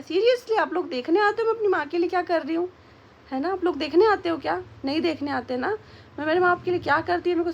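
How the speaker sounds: exasperated rebuke of rude commenters; indignant. Real, not acted. Scolding viewers over nasty comments.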